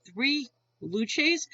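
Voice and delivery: baffled question with a rising end; retold blank disbelief, lifting at the end; puzzled echo. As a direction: repeat it as if it makes no sense. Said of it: "even I'm looking at him".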